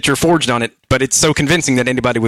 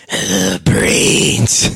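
whispered zombie moan; breathy undead groan on brains; 3 semitones lower than usual. Pretend. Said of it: "which is like a zombie".

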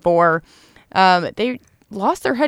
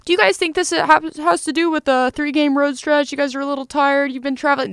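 higher, mock reporter quizzing the players; a lightly voiced locker-room reporter, higher; reporter's question; 9 semitones higher than usual. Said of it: "someone asked them".

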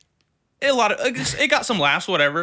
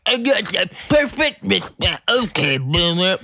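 slow, higher, louder smug mock voice; smug heckler; 6 semitones higher, 5 dB louder than usual. Comic imitates the heckler's smug line, hammed-up. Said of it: "he just like".

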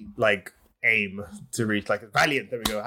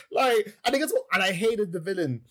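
ordinary talk, nothing marked

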